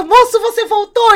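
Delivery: high, loud, delighted greeting; euphoric. Acted. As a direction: burst out happy to see him back. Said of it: "ela já tava toda eufórica". (moça eufórica, voz bem aguda e alta)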